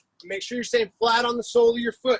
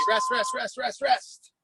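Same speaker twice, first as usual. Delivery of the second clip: calling out. Real, not acted. Coach calling end of interval.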